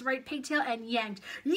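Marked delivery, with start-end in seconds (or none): 1.4-1.6 high, sudden shriek of pain; story character's full scream; piercing scream. A narrator's reading. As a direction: scream as if your hair were yanked. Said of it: "screamed Leslie".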